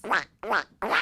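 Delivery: silly duck-call voice; funny duck quack. Shown as a demonstration. Host making a sound for children to guess, funny quack. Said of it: "That was a funny way to do it".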